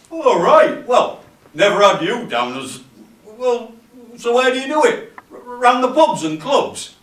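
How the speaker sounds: high, loud, impressed questioning; surprised workmate. Acted. Workmate asks newcomer where he does stand-up, surprised. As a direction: quiz him, taken aback.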